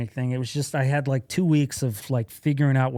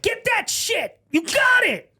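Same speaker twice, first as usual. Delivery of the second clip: yelling fan cheers; much slower than usual. Retold or imitated. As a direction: scream encouragement at a skating rink. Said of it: "'Cause I'd be like".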